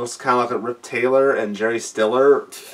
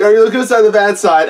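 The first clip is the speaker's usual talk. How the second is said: hammed-up quote; 6 semitones higher, 6 dB louder, faster than usual. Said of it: "Something like".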